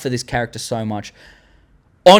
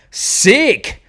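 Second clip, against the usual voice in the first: ordinary talk, nothing marked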